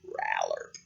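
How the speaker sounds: very low, soft frog croak; deep amphibian burp of a call. Acted. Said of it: "it sounds like".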